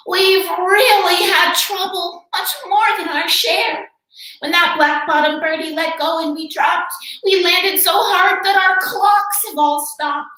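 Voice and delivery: high, small pleading voice; pleading tiny mayor. A narrator's reading. Tiny mayor pleading with the elephant for help. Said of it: "pleaded a voice".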